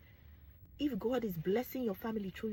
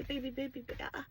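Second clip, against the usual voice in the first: mock wife; 5 semitones higher, 6 dB quieter, faster than usual. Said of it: "She'll say".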